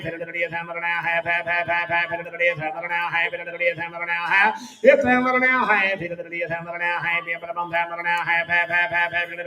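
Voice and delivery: usual speaking voice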